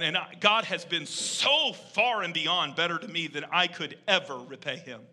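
ordinary talk, nothing marked

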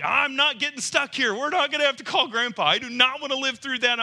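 high, loud, straining "I'm not getting stuck"; desperate vow. Retold or imitated. Stuck in the mud at night, swearing he won't call grandpa, high strained vow. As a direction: fight it out loud, refusing to give up.

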